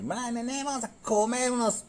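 high, mocking exaggerated Yucatecan accent. Mocking a comedian's fake Yucatecan accent to a friend. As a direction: parody the overdone comic Yucatecan voice. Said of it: "el otro gordito que".

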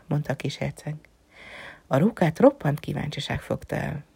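ordinary delivery, nothing marked

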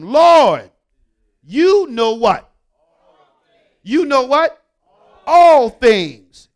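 loud, high, exasperated outburst; frustrated Peter. Acted. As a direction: cry out in wounded frustration. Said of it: "Peter was grieved, aggravated, frustrated".

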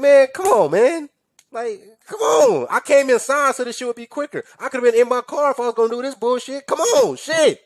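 very high, whiny mock complaint; squeaky, hammed-up frustration; exasperated pleading. Acts out exasperation at the wait.

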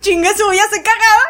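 loud, high, laughing resignation; laughing curse. Retold or imitated. Quoting herself giving up in the car, laughing loudly. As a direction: give in with a howl of laughter.

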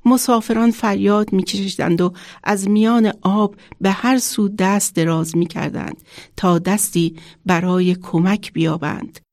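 usual speaking voice

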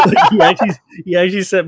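high burst of laughing, words laughed out; laughing hard while telling the story; real laughter. Real, not acted. Laughing at the memory.

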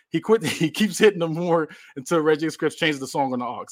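ordinary talk, nothing marked

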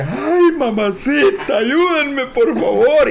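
high, drawn-out cry for help; mock awful yelling. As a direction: wail to wake the whole neighbourhood. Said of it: "yo gritaba bien ojete". (grito de auxilio fingido, agudo)

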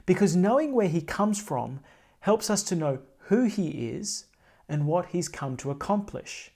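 normal talking voice

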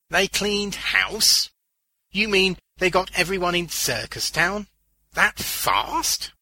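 loud, high incredulous questions; shocked disbelief. A narrator's reading. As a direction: demand to know, aghast. Shocked Beaners questions the news.

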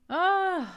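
high, loud, swooping "oh"; emotional "oh". Real, not acted. Host moved by a simple meal, emotional high "oh". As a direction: swell up high on a heartfelt oh. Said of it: "I'm getting emotional".

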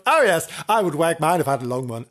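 plain reading voice

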